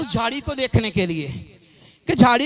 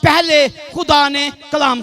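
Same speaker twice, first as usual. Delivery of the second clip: raised, declamatory sermon delivery; emphatic preaching; 3 semitones higher, 5 dB louder, slower than usual. Real, not acted. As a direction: proclaim it to the congregation in measured phrases. Proclaims a prophecy from scripture. (जोशीला उपदेश, बुलंद आवाज़ में)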